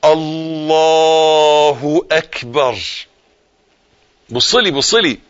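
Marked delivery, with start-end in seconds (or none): 0.0-2.8 very slow, stretched الله اكبر with long held vowels; drawn-out takbir. Shown as a demonstration. Preacher leading listeners to repeat the takbir, slow. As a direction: draw الله اكبر out slowly for them to copy. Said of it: "قولها".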